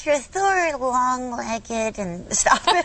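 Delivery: high, slow flirty lamb; coy little puppet voice; feisty puppet voice. Pretend. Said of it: "very feisty".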